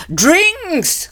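high, eager exclamation; a delighted high yelp; greedy cry. Acted. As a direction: pounce on the word.

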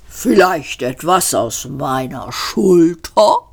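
langsam, gedehnte Rinderstimme; höfliches Rind. A narrator's reading. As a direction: ein freundliches Schlachttier, das sich anbietet. Das Tier preist sich an. (das Hauptgericht stellt sich den Gästen gemächlich vor)

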